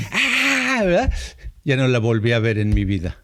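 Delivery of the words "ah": a quick high burst of an angry yell; imitating his own outburst; retold scream. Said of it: "grité".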